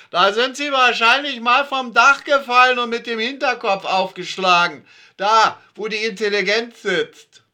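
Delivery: high, loud, sneering woman's jab; called-after insult. A narrator's reading. Frau Dörge calling an insult down the stairwell. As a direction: fling it back down the stairs. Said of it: "nicht ohne nachzurufen".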